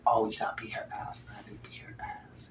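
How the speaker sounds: hushed whisper urging her to fight; whispered egging on. Retold or imitated. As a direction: whisper it like a sideline hype crowd. Crowd egging Natalie on.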